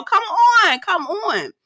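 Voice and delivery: high, falling come-on; excited, high-pitched hype; cheering on. Real, not acted. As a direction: egg them on with rising excitement. Cheers on the winner.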